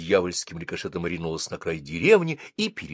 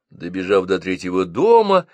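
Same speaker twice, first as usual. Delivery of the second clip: plain reading voice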